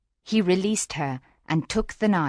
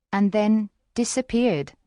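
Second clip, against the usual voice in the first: plain reading voice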